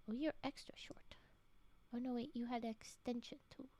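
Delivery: soft hushed whispering; focused whisper. Real, not acted. Streamer picks next story, focused whisper. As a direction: whisper while concentrating.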